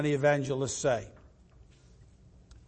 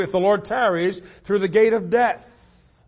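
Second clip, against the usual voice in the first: ordinary talk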